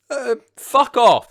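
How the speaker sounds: high, loud, slow exasperated retort. Real, not acted. Reacting to Red Stripe joke.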